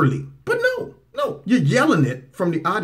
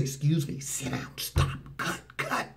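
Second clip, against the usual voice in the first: hushed, breathy imitation of an interrupting conductor; softly voiced conductor halting the music; conductor cutting in; 11 dB quieter than usual. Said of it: "the conductor's gonna say".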